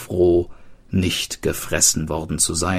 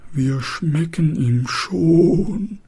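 trembling murmur; 9 semitones higher, slower than usual. Acted. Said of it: "murmelte zitternd".

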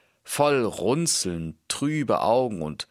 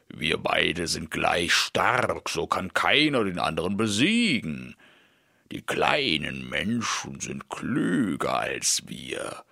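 giant voice. Acted. Giants speak to the youth. (langsame, schwere Riesenstimme)

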